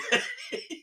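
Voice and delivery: sudden high-pitched self-conscious giggle; embarrassed high laugh about his weight. Real, not acted. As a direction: burst into a squeaky laugh at yourself. Laughing at his own belly.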